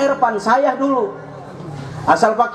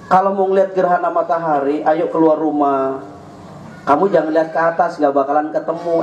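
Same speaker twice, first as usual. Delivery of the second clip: put-on parent coaxing a child; 9 semitones lower than usual. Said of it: "orang tua bisa bohongin anak".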